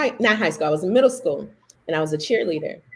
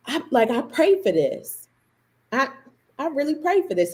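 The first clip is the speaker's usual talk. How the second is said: gasp, then higher, awed realization; retold amazement; 7 semitones higher than usual. Girl finding the skirt she prayed for, amazed. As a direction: act out stunned wonder after a gasp.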